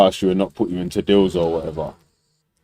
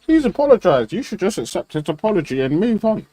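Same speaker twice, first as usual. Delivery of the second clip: mock Sunak; 10 semitones higher than usual. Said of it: "You say".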